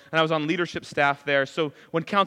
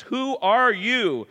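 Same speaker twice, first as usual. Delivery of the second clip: "Who are you" stretched slowly, pitched up; drawn-out question; 6 semitones higher, much slower than usual. Acted. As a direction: spell out "Who are you" word by word in disbelief. Acts listeners demanding his identity.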